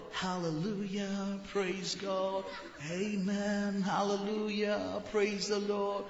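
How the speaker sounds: soft, breathy-free hushed piety sent up; a hammed-up hushed churchgoer; mock churchy murmur. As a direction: mouth the hallelujahs in a hushed show-off reverence. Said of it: "that's all stage drama".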